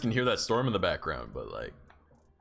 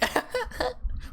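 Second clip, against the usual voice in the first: high falsetto giggle, breathless; a tiny cartoon-critter giggle; cute little laugh. Streamer imitating the mascot's cute laugh, high giggle. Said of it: "with this nice little cute laugh".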